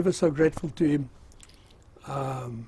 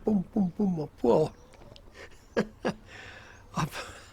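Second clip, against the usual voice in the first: ordinary delivery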